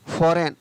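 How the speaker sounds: फॉरेन said as foren with no g; फॉरेन without the g; silent g. Shown as a demonstration. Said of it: "जी को नहीं पढ़ा गया".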